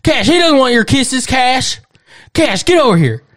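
Southern grandpa shouting for his dog; Southern granddad yelling. Retold or imitated. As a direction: holler across the yard at the dog. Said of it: "their granddad be like".

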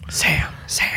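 breathy whispered call. Real, not acted. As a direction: whisper his name.

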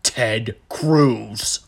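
slow, halting dopey impersonation; slow, heavy imagined politician voice; Kevin from The Office. Acted. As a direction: plod through it word by word. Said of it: "you just sound like Kevin from The Office".

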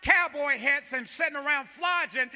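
impassioned pulpit outcry; fiery preaching. Real, not acted. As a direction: preach it hard, climbing to a full-voiced demand. Preaches at full force.

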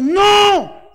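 high, loud no; loud, high preacher's rebuttal; emphatic shout. Real, not acted. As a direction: slam the answer down hard. Preacher rejects idea they were saints.

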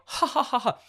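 very high put-on laugh; exaggerated mock ha-ha; imitated sensei laughing. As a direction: mimic the teacher joining the laughter. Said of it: "even sen-, sensei's laughing as well".

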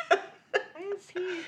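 high, slow, whiny confused question; baffled whine. Retold or imitated. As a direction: whine it like you truly can't place him. Baffled at seeing Flea again.

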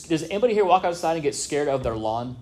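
ordinary talk, nothing marked

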